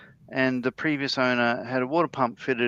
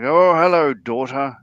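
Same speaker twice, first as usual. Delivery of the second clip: a lightly voiced pompous king, slow and louder; Monty Python king; 8 dB louder, slower than usual. Retelling the skit's king greeting his daughter, slow and mock-grand. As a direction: drag out a grand royal hello. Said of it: "a Monty Python skit".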